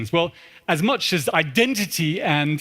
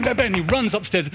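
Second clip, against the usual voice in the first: ordinary talk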